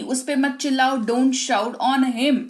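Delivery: usual speaking voice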